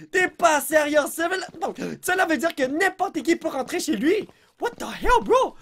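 high laughing shout of disbelief; excited disbelief. Real, not acted. Gamer sees every door unlock, shouting in laughing disbelief. Doors unlock, anyone can enter.

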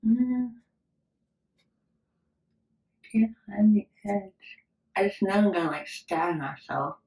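lips held apart by fingers; talking while picking at teeth; fingers in mouth. Real, not acted. Seen 4: Fingers and small purple tool held at open mouth throughout.

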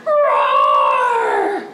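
big, long, loud tiger roar. Shown as a demonstration. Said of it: "Let's roar louder".

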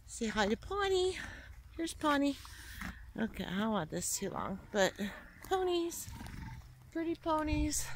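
high sing-song cooing to animals; cooing pet voice. Real, not acted. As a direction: coo sweetly at the ponies. Cooing at the ponies.

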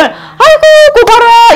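아이고 고바라 sung out high and slow in Gyeongsang style; Gyeongsang grandma. Retold or imitated. Teacher imitating elderly Gyeongsang ladies, high and slow. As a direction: imitate an old Gyeongsang lady admiring. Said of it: "할머니들이나 좀 연세 들리면".

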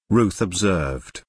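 plain reading voice

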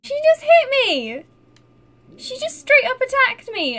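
high, loud indignant yelp; outraged surprise. Real, not acted. Streamer tells viewers her cat attacked her, loud and high. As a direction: protest that the cat hit you.

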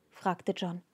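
plain reading voice

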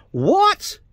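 high, loud exasperated what; outraged disbelief. Real, not acted. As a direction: explode in disbelief. Outburst of disbelief.

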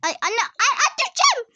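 high, laughing squeals while nearly falling; laughing high shriek. Real, not acted.

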